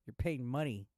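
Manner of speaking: normal talking voice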